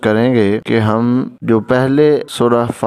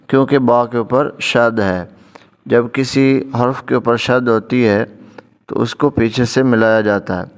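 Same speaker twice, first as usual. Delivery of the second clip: normal talking voice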